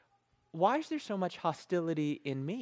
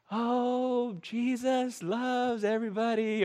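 slow, higher, dreamy sing-song voice; hammed-up holy calm; mock serene worshipper; 7 semitones higher, slower than usual. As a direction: drift it out like a blissed-out radio listener. Mimicking worship radio mood.

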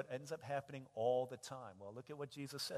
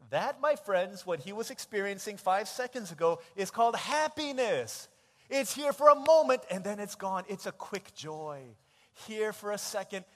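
preaching; 5 semitones higher, 13 dB louder than usual. Acted. Preaching point loudly.

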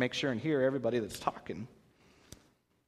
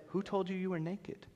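soft, higher, hushed question; quietly asking, much softer; 5 semitones higher, 10 dB quieter than usual. Shown as a demonstration. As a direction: ask it quietly but heavy. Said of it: "Or it might have been quiet".

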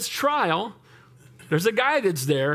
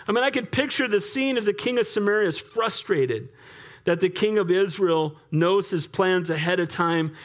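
normal talking voice

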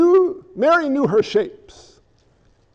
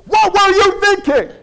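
high, loud angry scolding; a furious parent dressing down a child; shrill loud "what were you thinking"; 9 semitones higher, 4 dB louder than usual. Retold or imitated. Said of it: "you're not happy with the kid".

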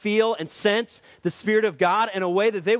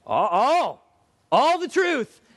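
building proclamation; 4 semitones higher, much slower than usual. Acted. Answers own question, building.

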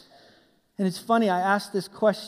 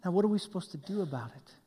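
ordinary talk

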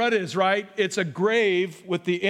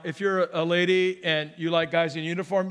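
normal talking voice

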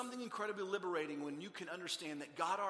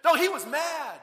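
loud, high exclamation; emphatic outburst; 8 semitones higher, 13 dB louder, slower than usual. Acted. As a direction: burst out to contradict, comically forceful. Insists the dad was really angry.

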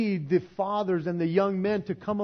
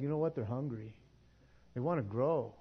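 normal talking voice

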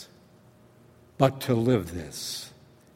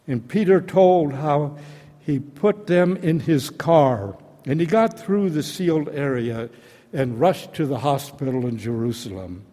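usual speaking voice

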